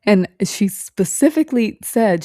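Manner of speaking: ordinary delivery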